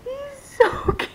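high squeal of adoration; melting with adoration. Real, not acted. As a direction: gush in a tiny high voice. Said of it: "it's melting my heart right now".

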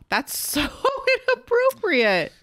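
high, loud scandalized laugh; laughing outrage. Real, not acted. Co-host reacts to the movie's plot, scandalized and laughing. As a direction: push it up high in amused shock.

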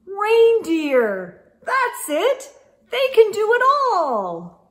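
high, loud eureka; thrilled discovery; excited. Acted. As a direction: burst out with the bright idea.

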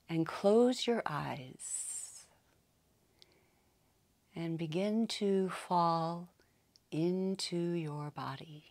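slow, calm meditation voice with long pauses; unhurried yoga nidra guidance, settling softly; guided meditation. Acted. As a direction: ease them gently into relaxation, phrase by phrase.

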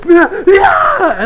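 high pained shout; anguished scream. Retold or imitated. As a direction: cry out in anguish, loud and high. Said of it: "Kirito's like".

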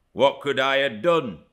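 aggrieved protest. A narrator's reading. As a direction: protest your innocence. Earnshaw protests at her accusation.

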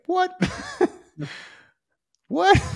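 very high-pitched, incredulous squeak; hammed-up shock, voice leaping sky-high; mock disbelief. Writer's shock at being told to publish.

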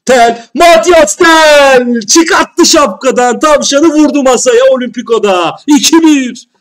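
stretched high roaring goal call; screaming with joy at a goal; goal celebration shout. Real, not acted. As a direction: roar the scorer's name, drawn out.

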